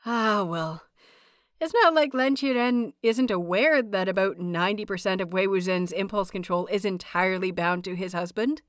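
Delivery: plain reading voice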